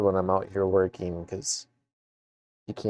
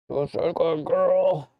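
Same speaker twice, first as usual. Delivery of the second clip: high slow praise for a dog; talking to a dog; 8 semitones higher, 3 dB louder than usual. Real, not acted.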